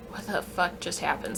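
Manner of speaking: very soft, stunned murmur; barely audible, dumbfounded aside; stunned hushed reaction. Retold or imitated. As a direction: say it under your breath in disbelief. Stunned group reaction, retold.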